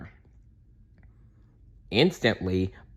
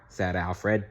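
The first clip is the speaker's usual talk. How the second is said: ordinary delivery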